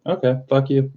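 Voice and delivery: lightly acted, high-pitched kiss-off; retold dismissive retort. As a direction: toss it off high, as a mock comeback.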